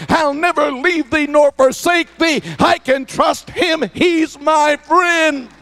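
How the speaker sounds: rising, shouted praise, slowed on key lines; preaching at full force. Acted. Pastor preaching to the congregation, full force. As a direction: lift the church with a call and answer.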